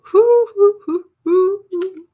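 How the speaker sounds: high, loud celebratory hoots; joyful whooping. Real, not acted. Host celebrating the election news, joyful whooping. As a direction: whoop repeatedly, high and loud, easing off at the end.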